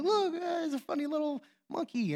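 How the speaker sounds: put-on high-pitched coaxing of a baby; playful baby-talk. Dad giving his baby a toy, playfully high-pitched. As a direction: distract a little one, voice lifted high.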